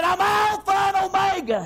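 high, ringing proclamation; preacher imitating a great voice from behind; great trumpet voice. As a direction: trumpet it out as a divine announcement. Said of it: "a great voice as of a trumpet".

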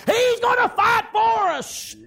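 high, loud, slow cry of faith; joyful cry. Retold or imitated. Marchers crying out in faith, loud and slow. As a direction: cry it out through tears of joy.

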